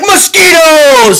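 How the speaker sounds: high, drawn-out mock battle cry; dramatic summoning shout. Prophet unleashing the next plague, dramatic shout.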